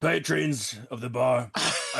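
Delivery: slow grand address to the bar crowd; speechify it slowly to the tavern; orator. Acted. Barbarian addressing the tavern patrons, slow and grand. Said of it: "please do oratory now".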